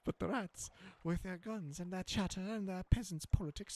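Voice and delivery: high, stately declaiming rodent lady; grand seneschal voice. Acted. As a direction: recite it like a court herald. Seneschal denounces the rats.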